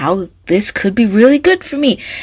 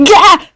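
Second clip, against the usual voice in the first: a laugh that breaks into a startled yelp; laugh, then yelp as you stumble; 10 semitones higher, 8 dB louder than usual. Acted. Natalie trips while laughing.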